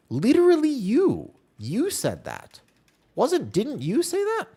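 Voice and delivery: high, drawn-out incredulous protest; incredulous pushback. Real, not acted. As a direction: throw it back at them, slow and high-pitched. Disputing what a chatter said.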